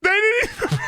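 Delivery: high, breaking into laughter mid-sentence; can barely get it out for laughing; squeaky helpless laughter; laughing hard. Real, not acted. Streamer cracking up at captions.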